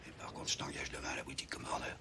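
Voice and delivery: whispered, quiet offer. Acted. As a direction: let him in on a secret softly. Boss drops to a confidential offer.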